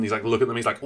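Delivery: ordinary talk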